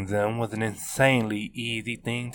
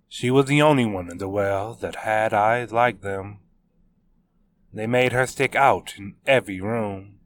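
ordinary delivery, nothing marked

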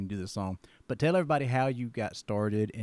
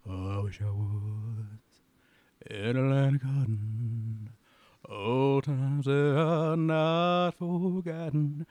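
slow, crooning [name], soaring much higher and louder on look away; 5 semitones higher, 5 dB louder, much slower than usual. Acted. Dad sings [name] for his guest as [name]. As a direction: sing it slow as [name], building to a big high climax. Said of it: "I could do it as [name]".

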